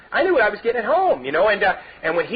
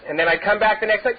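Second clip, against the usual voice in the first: ordinary delivery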